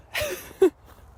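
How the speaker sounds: high burst of laughter; loud laugh. Real, not acted. Laughs after cheer.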